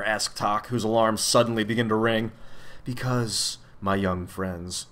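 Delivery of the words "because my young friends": slow, soft, sour grumble; sour mutter. A narrator's reading. The man answers his young visitors, muttering sourly. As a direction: mutter it low and slowly. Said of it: "he muttered sourly".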